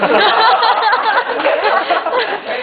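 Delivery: loud high laugh bursting out; big burst of laughter. Real, not acted. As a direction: crack up loudly at own story. Doctor story punchline.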